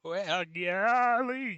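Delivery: high, very stretched-out Southern-style golly; Chad Snyder. Shown as a demonstration. Host attempting a Southern accent for his co-host, high hammed-up impression. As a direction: draw it right out in a put-on high voice. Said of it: "that's my best Chad Snyder".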